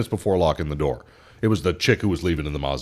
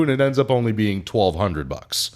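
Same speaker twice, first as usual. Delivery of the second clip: usual speaking voice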